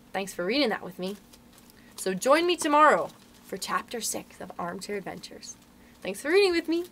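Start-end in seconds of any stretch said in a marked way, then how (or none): none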